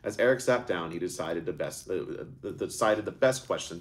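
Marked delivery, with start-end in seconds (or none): none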